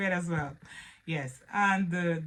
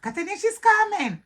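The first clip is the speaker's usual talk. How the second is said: a high, loud sing-song warning; mocking warning; 8 dB louder than usual. Relatives teasing as Katerina arrives, in a mocking warning. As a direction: imitate the relatives' teasing alarm. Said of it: "everyone started".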